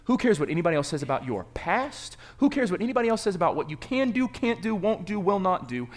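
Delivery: preaching at full force. Real, not acted. Preacher rallying the congregation, rising to a shout. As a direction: drive it home to the crowd.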